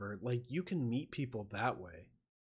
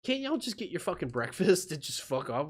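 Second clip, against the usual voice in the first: usual speaking voice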